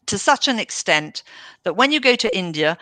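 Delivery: ordinary talk, nothing marked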